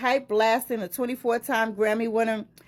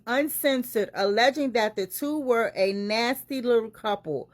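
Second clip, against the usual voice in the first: ordinary delivery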